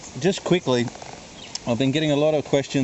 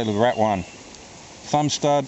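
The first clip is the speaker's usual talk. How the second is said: ordinary talk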